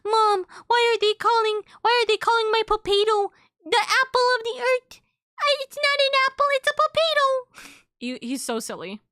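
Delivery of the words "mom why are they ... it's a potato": put-on much higher, wounded little-kid whine; a toddler upset that his potato got renamed; sad childlike pet. Pet complaining to mom about the name, high and hurt. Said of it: "He was like".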